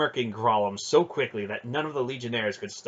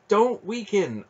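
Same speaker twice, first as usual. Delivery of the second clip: high-pitched firm urging; urgent command; 10 semitones higher, 4 dB louder, slower than usual. A narrator's reading. Aunt's voice urging the boy on, insistent. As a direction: keep him from faltering.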